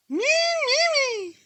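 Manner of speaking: very high squawking parrot; high bird cry. Acted.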